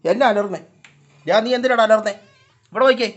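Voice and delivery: loud, high call to a cat; calling to a pet. Real, not acted. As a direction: raise your voice to question a noisy cat. (ഉറക്കെ പൂച്ചയോട് വിളിച്ചു ചോദിക്കുന്നു)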